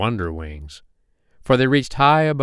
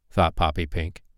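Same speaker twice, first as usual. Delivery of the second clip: plain reading voice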